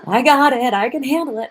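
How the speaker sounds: loud, high, panicked insistence; frantic. Shown as a demonstration. Coach showing followers the stressed way of coping, frantic and loud. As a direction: force it out like you're barely coping. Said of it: "Not like that".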